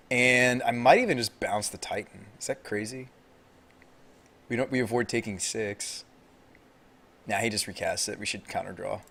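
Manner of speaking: normal talking voice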